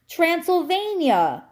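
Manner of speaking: high, drawn-out cry of triumph; triumphant cry. A narrator's reading. Cat names the rabbit's homeland, triumphant. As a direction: cry it out slowly as a victory. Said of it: "he cried triumphantly".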